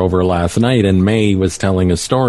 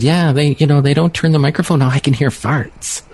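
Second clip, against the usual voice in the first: put-on higher-pitched child telling on classmates; lightly mimicking a child, pitched up; imitated granddaughter; 5 semitones higher than usual. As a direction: retell it as a grandchild would. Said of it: "She said".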